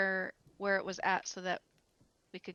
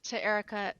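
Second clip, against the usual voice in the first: ordinary talk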